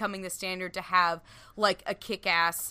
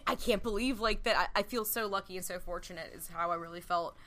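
ordinary talk, nothing marked

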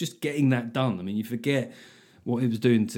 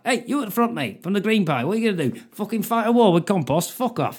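higher, louder heckler-baiting comedian patter; cheesy club comic; 8 semitones higher, 3 dB louder than usual. Pretend. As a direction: roast the front row like a cheeky old club comic. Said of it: "carrying on speaking like that".

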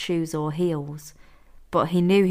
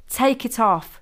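higher, insistent order; insistent repeat; 5 semitones higher, 4 dB louder than usual. A narrator's reading. Blindfolded Sean repeats his demand to captor, insistent. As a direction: press the demand harder. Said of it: "Sean repeated".

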